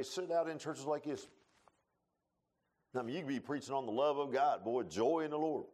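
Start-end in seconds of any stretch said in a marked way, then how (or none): none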